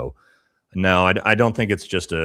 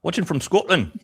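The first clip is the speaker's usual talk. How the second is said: much higher, louder Scottish accent; 12 semitones higher, 6 dB louder than usual. Shown as a demonstration. Host repeats a viewer's location, in a hammed-up Scottish accent. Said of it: "That was Scottish, bro".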